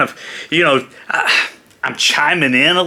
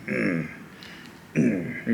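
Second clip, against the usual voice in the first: quiet Macho Man hum; 6 semitones lower, 12 dB quieter than usual. Shown as a demonstration. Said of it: "he goes".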